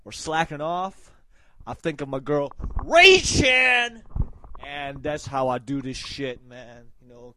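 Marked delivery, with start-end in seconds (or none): 2.9-4.0 big hype shout of a name, pitched way up. Acted. Vlogger hyping his girl on the show, big loud shout.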